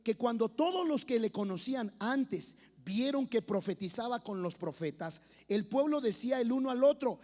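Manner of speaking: loud, rising preacher's reading of scripture; full-voiced sermon delivery; fervent preaching. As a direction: proclaim it to the congregation.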